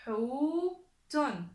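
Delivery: loud, split into two syllables; lecture appuyée. Shown as a demonstration. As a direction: hold the long Hu, pause, then tun. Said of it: "on écoute bien la lettre". (lecture forte, syllabes séparées)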